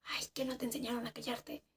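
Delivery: exasperated whisper; whispered scolding. Retold or imitated. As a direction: scold her without raising the voice. Omar scolding her in whisper.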